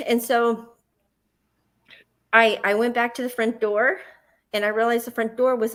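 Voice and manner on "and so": normal talking voice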